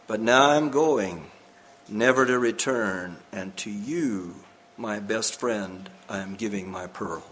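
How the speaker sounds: low, hushed and slowing, tinged with sorrow; solemn farewell. A narrator's reading. Old diver giving his best friend the pearl, solemn farewell.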